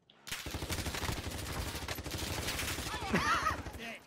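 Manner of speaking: breathless high laugh; high excited laugh. Real, not acted. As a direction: burst out laughing. Laughing after the kill.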